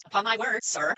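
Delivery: a lady's startled protest; reading Elizabeth. Acted. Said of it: "then I'll jump in for her".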